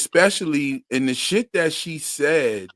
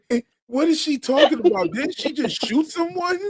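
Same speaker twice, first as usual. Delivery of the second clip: high incredulous mock voice, raised pitch; mock old lady asking in disbelief; 11 semitones higher than usual. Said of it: "the lady was like".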